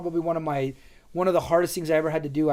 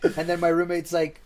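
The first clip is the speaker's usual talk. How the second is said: ordinary talk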